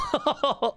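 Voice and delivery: high amused laugh. Real, not acted. Streamer judging both words terrible, laughing high.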